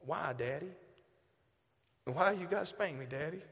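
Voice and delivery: put-on soft small-child plea; daughter asking. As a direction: ask quietly like a little girl.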